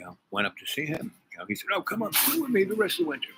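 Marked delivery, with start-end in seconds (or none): 1.7-3.3 higher put-on voice inviting her up; mock invitation. Mimicking him inviting her up, higher voice. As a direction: lift the voice to mimic his coaxing.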